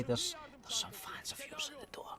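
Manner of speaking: hushed whisper, stumbling start; mum says quietly. Retold or imitated. Mum whispering to son about fans at the door. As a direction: whisper the news so the fans won't hear. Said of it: "says quietly".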